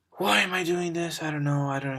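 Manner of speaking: sudden high, loud "why am I doing this"; exasperated outburst. Real, not acted. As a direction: burst out at yourself in disbelief. Exasperated outburst at own breathing.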